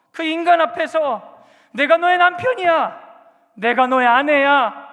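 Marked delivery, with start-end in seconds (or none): 1.7-4.9 slow, loud, higher cry of a betrayed spouse; a desperate cry; anguished outcry. Retold or imitated. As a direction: wail it at the unfaithful partner. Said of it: "비참하지만 강력하게 돌아오라고 절규하는".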